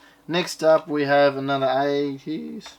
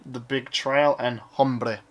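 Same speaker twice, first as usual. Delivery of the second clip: usual speaking voice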